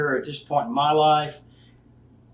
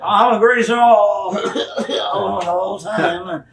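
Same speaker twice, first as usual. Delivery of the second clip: slow, stretched mock acceptance speech; feeble old legend; 4 semitones higher, 4 dB louder, slower than usual. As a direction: play a washed-up old-timer thanking the crowd. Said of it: "I would have to limp in there".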